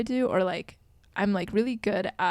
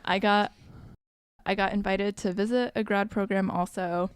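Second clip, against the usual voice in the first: usual speaking voice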